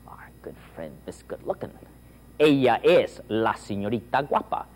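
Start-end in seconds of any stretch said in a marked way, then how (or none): 0.1-1.7 hushed teasing whisper; conspiratorial whisper. Shown as a demonstration. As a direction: lean in and whisper the nickname. Teasing aside to audience.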